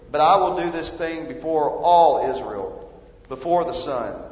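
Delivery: loud, ringing reading of God's judgment; preacher thundering the prophet's words; forceful scripture reading. Acted. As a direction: proclaim it like a prophet.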